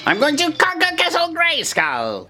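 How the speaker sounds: slow, very high villain boast; Skeletor. Shown as a demonstration. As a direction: proclaim it as a theatrical high-pitched villain. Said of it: "You can say".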